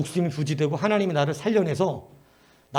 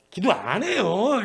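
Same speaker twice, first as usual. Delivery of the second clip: mocking the teacher; 4 semitones higher, much slower than usual. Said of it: "그 나한테".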